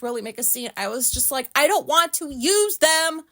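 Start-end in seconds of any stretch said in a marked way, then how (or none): 1.5-3.2 retold loud refusal. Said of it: "it was pretty loud".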